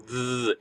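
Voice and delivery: "th-" held with vibration, as in "they"; voiced buzzing TH. Shown as a demonstration. Teacher demonstrating a consonant, vibrating "th-". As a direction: buzz the tongue between the teeth on "th-". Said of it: "it's, it's vibrational".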